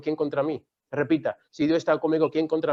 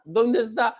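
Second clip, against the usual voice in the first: ordinary talk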